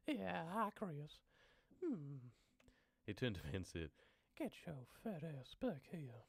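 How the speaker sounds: high-pitched, drawn-out put-on voice; high cartoonish threat; put-on character voice. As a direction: act the unhinged character up high. Acting the story character's voice.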